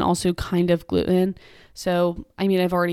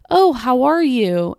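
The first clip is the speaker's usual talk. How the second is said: higher, louder, drawn-out greeting; mock friendly greeting; 5 semitones higher, 6 dB louder, slower than usual. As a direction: imitate an effusive passer-by, slow and high. Acting out a passer-by's greeting.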